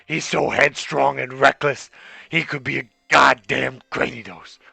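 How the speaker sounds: low, soft raspy smoker mom; smoker's rasp. Acted. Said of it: "This is Barry's mom now".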